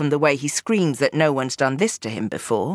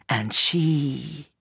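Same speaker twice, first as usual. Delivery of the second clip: much lower, softer, very slow; hushed final line; 6 semitones lower, 6 dB quieter, much slower than usual. A narrator's reading. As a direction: let it land heavy and soft. Climactic killing line.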